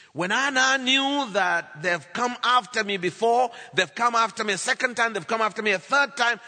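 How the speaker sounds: high, loud, rhythmic repetition; full-force preaching. Real, not acted. Preacher recounting repeated attacks to congregation, full-force. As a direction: drive each repeated phrase home at a shout.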